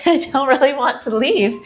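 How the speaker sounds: real laugh while admitting she loves it. Real, not acted. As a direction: giggle through the line, half joking. Touring bathroom, repeats line laughing.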